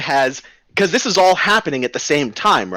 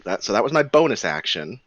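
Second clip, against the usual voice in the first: normal talking voice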